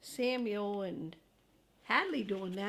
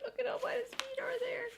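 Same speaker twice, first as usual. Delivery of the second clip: cutesy aside; 14 semitones higher, much faster than usual. Real, not acted. Parent pointing at the picture, high and quick. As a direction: coo it quickly to the little one.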